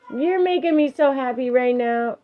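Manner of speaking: high, sing-out parental praise; encouraging praise. Real, not acted. Parent praises child for tidying.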